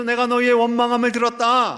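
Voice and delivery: loud, stern imitation of a rebuke; mock scolding. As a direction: play God telling them off. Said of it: "하고 혼내시는 것이 아니라".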